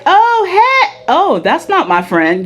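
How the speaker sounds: a high startled "Oh" then a light double take; mock surprise. To listeners, about switched hairstyles: hammed-up surprise. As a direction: play the friend who can't recognize her. Acting out not recognizing friend.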